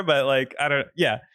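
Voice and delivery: ordinary talk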